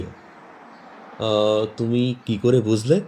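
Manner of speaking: plain reading voice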